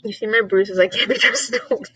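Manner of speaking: usual speaking voice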